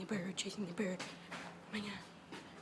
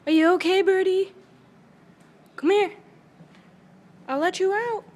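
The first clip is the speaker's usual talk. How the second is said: loud, higher worried calling; 4 semitones higher, 10 dB louder than usual. Real, not acted. Calling out loudly to check the trapped bird is okay. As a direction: call out with worry.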